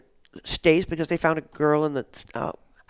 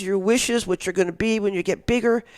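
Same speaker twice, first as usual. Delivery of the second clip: normal talking voice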